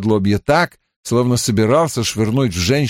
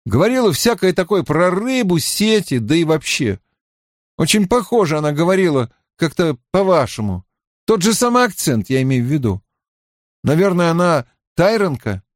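higher, conversational character voice; raised pitch, casual probing; lightly voiced Mat; 6 semitones higher than usual. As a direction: feel her out with a lightly acted remark. Narrator voices Mat fishing casually.

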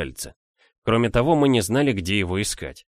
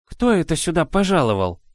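worried; 8 semitones higher, 3 dB louder than usual. A narrator's reading. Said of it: "заволновалась Банна".

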